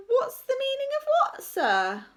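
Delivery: high-pitched innocent question; feigned innocence. A narrator's reading. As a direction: ask it sweetly, as if clueless. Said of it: "asked Rebel innocently".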